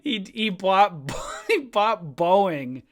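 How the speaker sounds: talking through laughter, slow and loud; can barely get it out for laughing. Real, not acted. Laughing to viewers about a guy buying Boeing.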